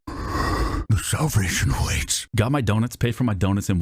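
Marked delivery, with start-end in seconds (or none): 0.1-2.3 drawn-out, voiceless demon rasp; dropped demonic voice. Retold or imitated. Tanned customer scaring the rude cashier, dropped demonic voice. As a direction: stretch it out like a possessed demon. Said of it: "I dropped my voice down".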